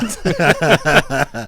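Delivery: loud burst of laughter. Real, not acted. Host laughing after jabbing his critics, loud laugh. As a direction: crack up at your own jab.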